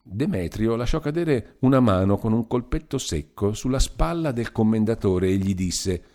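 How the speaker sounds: usual speaking voice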